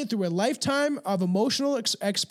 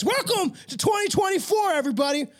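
excited shout; 10 semitones higher than usual. Real, not acted. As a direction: shout it out to the viewers. Festive greeting to viewers.

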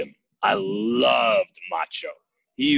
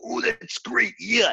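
imitating Macho Man; 10 semitones higher, slower than usual. Macho Man reacts to trick.

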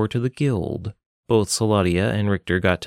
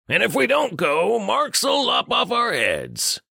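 high nasal-free gruff friend; 12 semitones higher, slower than usual. Acted. Richter calls to Saladia from behind, high put-on voice. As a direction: call out from behind her as Richter.

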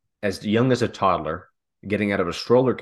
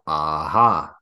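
gotcha lawyer; 5 semitones lower than usual. Retold or imitated. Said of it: "And they would go".

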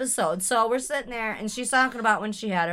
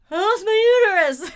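high loud tearful cry; panicked wail; 10 semitones higher, 8 dB louder than usual. Retold or imitated. Neighbor wails to the nurse in panic. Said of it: "the neighbor's like".

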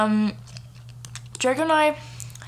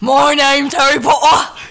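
loud, high mock English accent; hammed-up English accent, belted out; Harry Potter; 6 semitones higher, 11 dB louder than usual. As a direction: shout it in a silly English accent. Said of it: "Do I have to do the voice?".

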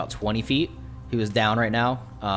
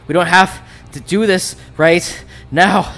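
slow, higher, louder pleading; wounded villain voice; 6 semitones higher, 7 dB louder, slower than usual. Acted. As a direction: beg for a truce, winded.

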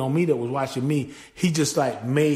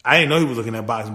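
normal talking voice

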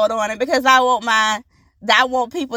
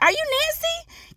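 much higher, slow, sing-song question. Retold or imitated. Host checking a guest's name, high and slow. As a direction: ask it lilting and hopeful. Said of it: "I was like".

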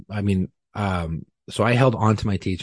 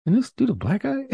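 high, disbelieving question; incredulous. Retold or imitated. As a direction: ask it in pure disbelief.

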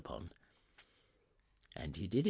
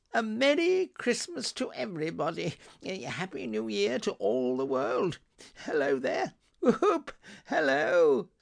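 high, loud whooping greetings; jubilant calling out; 7 dB louder than usual. Acted. Scrooge greeting passers-by on Christmas Day, jubilant shout. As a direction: call out joyfully to the whole street. Scrooge hailing everyone in street.

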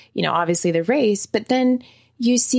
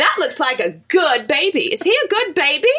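higher, lively Southern diner talk; Southern accent; 5 semitones higher, 3 dB louder than usual. Retold or imitated. Man at the counter fussing over a baby, Southern accent. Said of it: "pick up the Southern accent".

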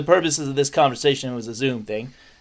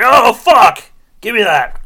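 loud, high burst of panic, easing off; mock alarmed parent; 6 semitones higher, 10 dB louder, slower than usual. Joking parent grabbing drugs from child, mock alarm. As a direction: blurt it like a parent caught out.

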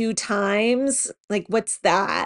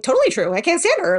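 higher, louder, bouncy quick quote; bouncy Vicky; 9 semitones higher, 3 dB louder, faster than usual. Retold or imitated. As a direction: mimic Vicky's bubbly snap answer. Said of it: "her weird kind of Vicky bouncy self".

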